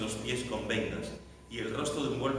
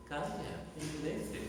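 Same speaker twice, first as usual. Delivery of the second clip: small, soft, higher-pitched voice; meek command; 4 semitones higher, 7 dB quieter, slower than usual. Shown as a demonstration. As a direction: say it feebly, as if it could never still a storm. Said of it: "No lo creo". (orden flojita, con voz suave y aguda)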